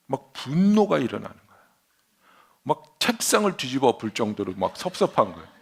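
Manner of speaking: normal talking voice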